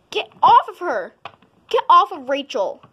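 loud commanding yell; shouting. Acted. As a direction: yell to pull him off. Defending friend in fight.

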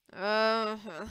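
muffled, half-awake grunt; sleepy mumble. A narrator's reading. Nancy being woken up, sleepy mumble. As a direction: mumble it as if into a pillow. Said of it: "Nancy mumbled, burying her face under the pillow".

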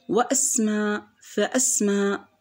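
وأسماء، فأسماء with a glottal stop after wa and fa; hamza pronounced. Shown as a demonstration. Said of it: "هنا الهمزة همزة قطع".

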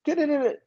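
high sung da-da-da; vocalised beat. Retold or imitated. Imitating the intro he plays for J Lo, sung high. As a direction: scat the music cue up high.

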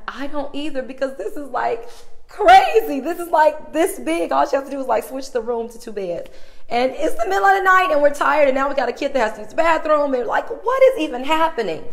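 high, loud, frantic venting; tired mom venting about the clerk, high and loud; exasperated rant. Retold or imitated. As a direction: pour out disbelief to the husband. Venting to husband at midnight.

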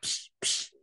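soft voiceless "psh" puffs; imitated snoring puffs. A narrator's reading. Animals hearing a sound under the bed, hushed puffs. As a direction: puff out two hushed little snores. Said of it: "it went".

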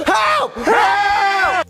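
high, urgent holler; shouting for help. Acted. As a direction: yell desperately to be rescued.

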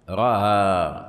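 رأى with the hamza eased between hamza and alif; softened hamza. Shown as a demonstration. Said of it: "يعني ستقول". (رأى بهمزة مسهلة بين الهمزة والألف)

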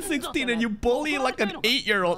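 high-pitched, pained protest; distressed outcry. Real, not acted. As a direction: plead in a high, upset voice. Upset at child being bullied.

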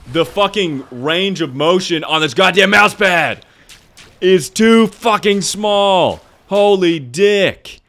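high, loud yelling rage; real gamer rage, voice way up; furious rant. Real, not acted. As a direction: shout it, stretching the last words. Raging at mouse pad during game.

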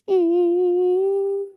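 loud choked sob; wife sobbing over her sick mother. Acted. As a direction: let out a crying sob. Daughter crying over mother.

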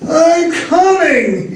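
long-drawn high wail; drowsy, drawn-out call; slow, sleepy wail. A narrator's reading. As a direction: wail it groggily, stretching each word. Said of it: "he wailed in a slow, sleepy voice".

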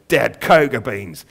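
slow, loud raven croak with a Cockney twang. Acted. Raven correcting Aubrey about chocolate, slow loud croak. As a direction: croak it with dark glee. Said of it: "Corax croaked".

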